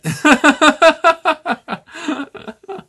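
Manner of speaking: long, loud, high-pitched laugh; high laughter. Real, not acted. Laughs after repeated nopes.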